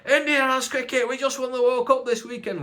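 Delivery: high, loud gloating troll voice; boasting troll. Retold or imitated. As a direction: crow it like a proud fan. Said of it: "they're all going".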